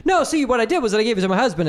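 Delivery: high, fast imitated babble; mock flustered excuse. Woman doubling back on her story about the tickets, mock flustered.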